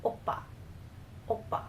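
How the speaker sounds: "oppa" with a toned-down B in the middle; careful "oppa", slight B in "pa"; slight B sound. Shown as a demonstration. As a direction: say "oppa" with a light B, not an aggressive one. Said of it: "a more slight".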